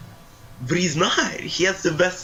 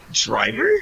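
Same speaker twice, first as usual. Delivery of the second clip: mock surprise; 10 semitones higher than usual. Mock overhearing, high question.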